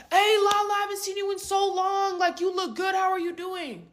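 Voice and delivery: high, sustained, put-on greeting voice; Riley. Date greeting his girlfriend's friend, affected high voice. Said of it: "When did you learn to talk like that?".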